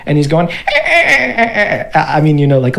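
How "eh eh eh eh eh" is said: high wheezy little giggles; mischievous gnome laugh. Acted. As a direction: cackle like a tiny gnome. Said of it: "a little gnome laugh".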